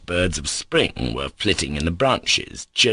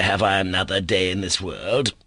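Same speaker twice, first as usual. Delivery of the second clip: ordinary delivery, nothing marked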